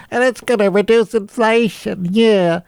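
high-pitched put-on parody voice; mocking voice. Mocking those who want a recession, high silly voice.

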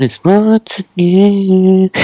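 high, slow, eerie singsong; eerie high taunt; creepy high. Acted. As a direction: draw it out eerily high. Creepy villain line.

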